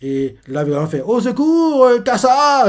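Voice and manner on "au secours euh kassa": high, loud call for help; cry for help. Retold or imitated. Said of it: "crier comme ça". (appel à l'aide, aigu et fort)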